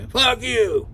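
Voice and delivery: high-pitched curse without full shouting; retold angry refusal. Mike turns down the ride, imitated angry refusal. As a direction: spit back a refusal, imitating him.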